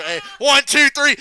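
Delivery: high, loud three-count; imitated ring count, punched out loud; mock referee count. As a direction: shout the pinfall count like a referee.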